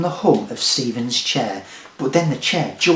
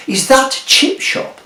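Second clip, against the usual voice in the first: surprised sniff question; 11 semitones higher, 7 dB louder, slower than usual. Acted. [name] smelling chips, surprised.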